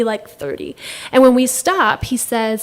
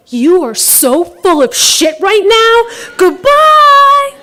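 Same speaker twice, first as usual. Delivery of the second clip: louder, with "Goodbye" jumping very high; loud parting shot; 8 semitones higher, 6 dB louder, slower than usual. Retold or imitated. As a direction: tell him off and slam the door.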